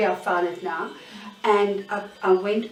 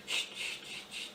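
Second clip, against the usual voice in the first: breathy unvoiced shushing; hushing whisper; 13 dB quieter, faster than usual. Intruder at her bedside, whispering shh to silence her. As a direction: hush her in a soft whisper.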